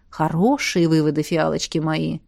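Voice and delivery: low, softening, smiling praise; warm praise. A narrator's reading. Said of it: "тепло улыбнулась Александра". (тепло, с улыбкой)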